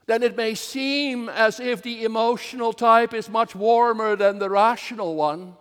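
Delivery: ordinary talk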